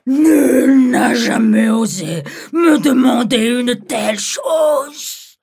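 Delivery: loud, indignant dragon outcry; outraged dragon. Acted. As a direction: roar out the outrage. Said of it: "dit-il".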